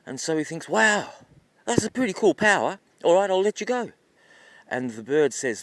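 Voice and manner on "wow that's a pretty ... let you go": higher, louder wowed reaction; impressed. Acted. Hunter to bird about a cool power, impressed. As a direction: react wowed, voice lifted and punched up.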